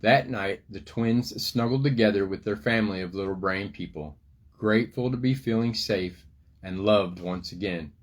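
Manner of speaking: lively picture-book storytelling voice; reading to children. A narrator's reading. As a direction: tell the story to little kids.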